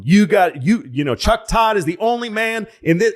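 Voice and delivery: a high, loud rally-stage pitch; a hammed-up candidate selling his man to the crowd; Clinton stumping. Clinton campaigns for the Democrat in a loud high rally voice. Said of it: "two days just going".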